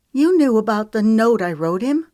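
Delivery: upset friend, a touch tearful; sniffing. A narrator's reading. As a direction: say it through sniffles, mildly. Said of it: "she sniffed".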